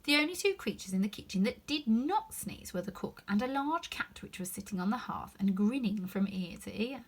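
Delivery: usual speaking voice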